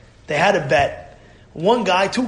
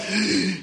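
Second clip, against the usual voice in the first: quick sucked-in breath; brief demonstrative gasp; sharp gasp; 5 dB quieter than usual. Shown as a demonstration. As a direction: catch the breath as temper flares. Imitates the momentary flash of anger.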